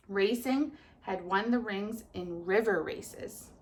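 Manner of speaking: ordinary talk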